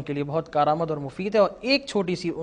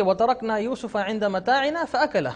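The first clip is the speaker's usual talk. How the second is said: slow, higher recitation halting on "फअकला"; mistaken early stop; 5 semitones higher than usual. Retold or imitated. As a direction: draw it out and end wrongly at "फअकला". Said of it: "पढ़ा कि".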